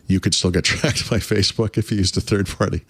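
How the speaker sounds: talking through laughter; laughing. Real, not acted. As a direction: laugh breaking into the sentence. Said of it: "I don't know why I'm laughing".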